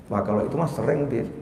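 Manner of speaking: soft, low shameless admission; husband cheekily admits to the preacher; sheepish husband. Retold or imitated. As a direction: confess it softly and cheekily. Mock husband admitting sin.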